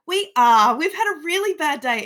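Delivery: loud, high-pitched imitation of a stressed friend; hammed-up stressed retort; retold distressed outburst. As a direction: act out their upset, pushed high and loud. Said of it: "And Wicked's like".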